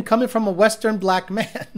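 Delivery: ordinary delivery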